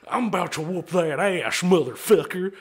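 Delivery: put-on drunk Texan biker threat; hammed-up brawler's challenge; threatening biker. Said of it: "And then they're hanging".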